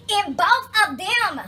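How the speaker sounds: high, loud, drawn-out outrage building with each repeat; indignant. Real, not acted. Telling viewers about the dug-up planters, indignant. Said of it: "I was not really happy about it".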